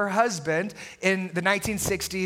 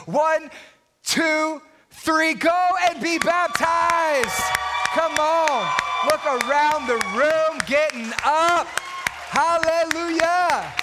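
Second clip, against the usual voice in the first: high, loud, stretched preacher call; rally the room like a revival preacher; slow, ringing hype over the crowd; preaching at full force; 7 semitones higher, 4 dB louder, slower than usual. Acted. Rallying church to get baptized.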